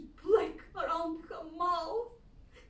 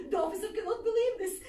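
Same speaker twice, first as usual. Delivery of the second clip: normal talking voice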